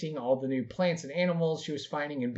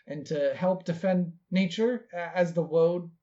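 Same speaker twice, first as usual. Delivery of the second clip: put-on earnest young druid; 5 semitones higher than usual. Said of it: "she'll be like".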